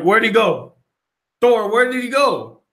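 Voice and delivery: mock urgent call. Retelling Steve calling to Thor.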